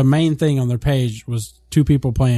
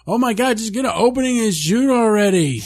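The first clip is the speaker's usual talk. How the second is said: exasperated; 8 semitones higher than usual. Retold or imitated. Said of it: "And I was like".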